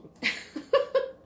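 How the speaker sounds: squeaky rising laugh; a bright high giggle. Real, not acted. Laugh after yay me.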